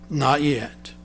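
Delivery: soft, low and calm; a patient master quietly refusing; gentle. Acted. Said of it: "The master potter simply said".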